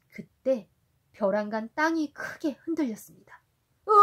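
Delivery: gentle storybook reading for a little one; reading to a toddler. A narrator's reading. Parent reading Sindbad to a child. (아이에게 동화 읽어주듯)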